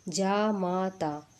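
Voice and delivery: very slow जामाता, three long syllables; correct vowel demo. Shown as a demonstration. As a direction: dictate जामाता as "jaa ... maa ... taa". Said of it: "जमाता कैसे लिखते हैं".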